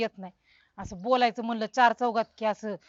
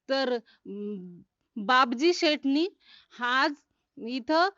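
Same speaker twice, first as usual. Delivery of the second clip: halting, slow speech practice; getting stuck; much slower than usual. Pretend. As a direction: stumble through with gaps between words. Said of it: "गुतू नका, गुतू नका". (अडखळत भाषण)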